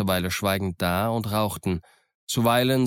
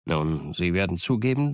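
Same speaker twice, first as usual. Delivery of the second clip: usual speaking voice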